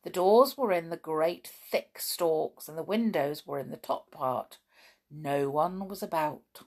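ordinary delivery, nothing marked

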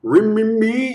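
high held guitar-like notes; vocal guitar solo imitation. Shown as a demonstration. Showing viewers the vocal guitar solo, guitar imitation. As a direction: mimic a guitar solo with your voice. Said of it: "something called the vocal guitar solo".

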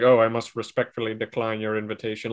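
put-on prim old Hollywood mid-Atlantic accent. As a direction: mimic a thirties film star, mid-Atlantic accent. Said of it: "And it's like".

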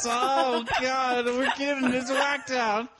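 high, slow wailing panic; high frantic wail. Acted. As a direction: stretch it out in mock panic. Mock frantic improv.

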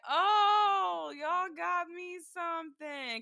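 high-pitched, drawn-out surprise; delighted mom. Retold or imitated. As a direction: play a touched mom receiving a present. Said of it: "her reaction is so lame".

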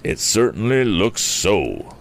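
slow, drawn-out concession; reluctant admission. A narrator's reading. Cornered thief Morse gives in, slow grudging admission. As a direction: concede it slowly in character. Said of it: "admitted Anson Morse".

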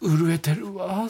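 slow, hushed, lovestruck line; an actress sighing over a heartthrob; breathy whispered swoon. Retold or imitated. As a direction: whisper it as if trembling with love. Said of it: "っていうの".

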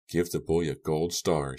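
ordinary delivery, nothing marked

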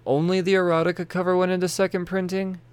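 high disbelieving question; can't believe it, voice jumps up; surprised. Real, not acted.

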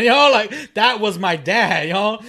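high, loud laughing. Real, not acted. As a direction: laugh through the words. Laughing at the memory.

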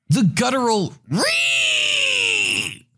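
high, piercing "Ree!" screech; guttural screech. Retold or imitated. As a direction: shriek it like an enraged kid. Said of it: "released from both her and her entitled child".

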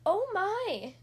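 a little shocked cry; cried out. Acted. Kitten reacting to the thermometer story, alarmed cry. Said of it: "cried Kitten".

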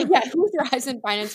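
laughing high and loud through the words; amused echo of co-host's quip, laughing loudly. Real, not acted. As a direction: laugh as you echo the joke back.

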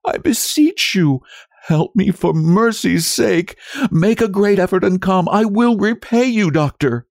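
very high, beseeching voice; frantic wife's drawn plea; moaning in despair. A narrator's reading. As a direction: beg desperately, pitched right up. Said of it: "moaned in despair".

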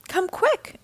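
high-pitched, urgent call without shouting. A narrator's reading. Una summoning the Guppies to hurry, calling out. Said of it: "Una called".

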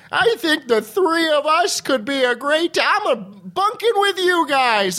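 high-pitched eager voice; high mock Foley voice. Matt Foley quote, raised.

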